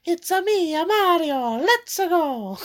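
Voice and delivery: high cartoon Italian plumber, bouncy and loud; Mario Italian accent. Friend impersonating Mario's famous line, high cartoon Italian. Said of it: "so they're like".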